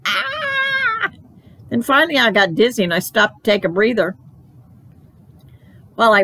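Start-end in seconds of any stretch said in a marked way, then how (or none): 0.1-1.1 shrill high playful shriek; scary squeal. A narrator's reading. Girl circling trick-or-treaters with a shrill scary squeal. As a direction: squeal like a little monster. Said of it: "ran all around them in a scary circle".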